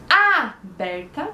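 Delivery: "aberta" with a long, leaning first A, slowly; wrongly stressed "aberta", weight on its first syllable. Shown as a demonstration. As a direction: stretch the opening A of "aberta", then drop the rest. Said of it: "o pedacinho que a gente fala mais forte".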